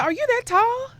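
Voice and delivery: a coach who can't believe her eyes; imitating the coach. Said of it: "She said".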